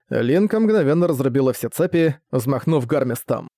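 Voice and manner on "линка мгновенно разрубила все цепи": plain reading voice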